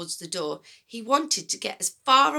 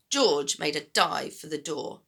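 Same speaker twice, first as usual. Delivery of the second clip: ordinary delivery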